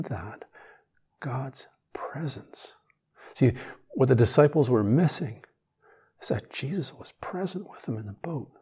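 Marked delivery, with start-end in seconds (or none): 0.0-2.7 whisper. Real, not acted. Meditative close of phrase.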